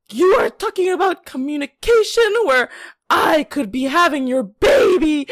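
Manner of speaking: high, loud tearful outburst; dramatic crying shout. Retold or imitated. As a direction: wail it through tears. Girl's dramatic tearful video rant.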